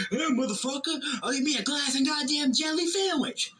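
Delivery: high mock angry old man voice; imitating Dad yelling. Dad mocking the manager, in a high hammed-up rant. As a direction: ham up Dad's defiant rant. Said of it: "that's word for word what he said".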